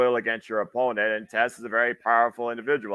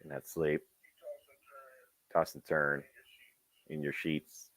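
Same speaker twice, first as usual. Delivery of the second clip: lower, softer mock-mournful recital; solemn poem reading; 7 semitones lower, 11 dB quieter than usual. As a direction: read it like a heartbroken poet. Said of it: "he's reading a, a poem".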